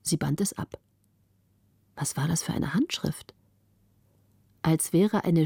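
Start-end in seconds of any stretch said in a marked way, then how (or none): 1.9-3.4 whispered puzzled question; hushed wonder. A narrator's reading. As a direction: ask it under the breath. Puzzling over strange letter.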